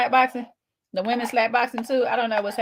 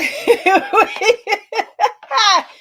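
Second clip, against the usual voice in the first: big burst of laughter; loud high laugh; 13 semitones higher, 7 dB louder than usual. Real, not acted. Big laugh at fight-night idea.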